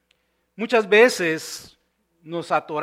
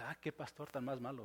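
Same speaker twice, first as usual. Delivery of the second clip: low, very soft mock grumble; muttered aside; 8 semitones lower, 23 dB quieter than usual. Congregant privately judging the pastor, muttered mock aside. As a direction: imitate a listener grumbling under their breath.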